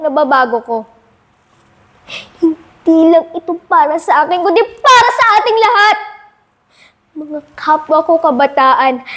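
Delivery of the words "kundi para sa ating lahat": ordinary talk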